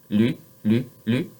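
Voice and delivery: "le" with a light tongue-tip "l"; French L. Shown as a demonstration. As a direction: clear French "le". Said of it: "that is this one".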